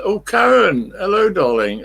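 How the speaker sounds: light up to greet a friend who just joined; delighted greeting. Real, not acted. Streamer greeting viewer Karen as she arrives, delighted high greeting.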